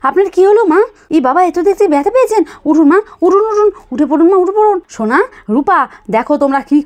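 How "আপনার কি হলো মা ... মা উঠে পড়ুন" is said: startled daughter-in-law urging her up; alarmed. Acted. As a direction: rush to help the fallen old woman. Daughter-in-law finds mother-in-law fallen.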